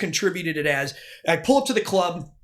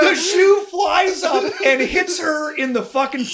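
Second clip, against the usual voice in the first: louder and higher, laughing while telling; laughing excitement; 6 semitones higher, 5 dB louder than usual. Real, not acted. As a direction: build to the punchline with laughter. Laughing climax of the story.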